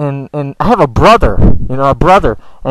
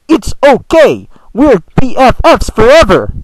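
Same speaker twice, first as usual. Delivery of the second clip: slow, mock robotic reassurance; a hint of a robot reading a line; 4 semitones higher than usual. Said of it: "why the fuck are you talking like a robot".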